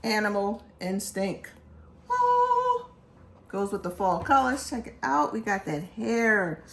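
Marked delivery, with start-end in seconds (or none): 2.1-2.9 a high, loud oh of delight; amazed gasp. Real, not acted. Collector opening the box, crying out in delight. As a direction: react like you just saw treasure. Unboxing the sneaker, awed.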